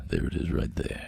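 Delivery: low, husky, barely voiced; showing off his croaky sexy voice. Real, not acted. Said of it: "I'm quite liking it".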